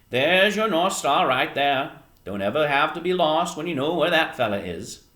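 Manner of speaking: folksy fisherman giving advice; old fisherman, instructing. Acted. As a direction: point it out as an old sailor. Old fisherman shows Edward star.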